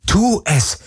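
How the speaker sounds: high, desperate inner cry; inner scream. Acted. Heroine to herself at gunpoint, screaming inwardly. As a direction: urge yourself in a high, contained scream. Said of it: "schrie alles in ihr".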